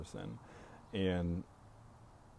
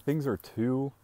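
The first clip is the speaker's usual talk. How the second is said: higher, louder, groping for the word; searching for a word; 7 semitones higher, 6 dB louder, slower than usual. Real, not acted. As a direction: stall while hunting the word. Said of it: "what's the word I wanna use?".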